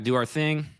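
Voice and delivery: ordinary talk, nothing marked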